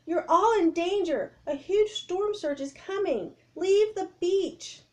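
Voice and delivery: high, loud, pleading alarm; urgent shouted warning. Acted. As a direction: drive them off the beach. Annie warning crowd of storm.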